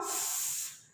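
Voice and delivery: a breathy, voiceless f- hiss with no vowel; isolated f sound. Shown as a demonstration. Teacher models for the class the starting sound of fish, a voiceless F-. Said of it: "What is the starting sound?".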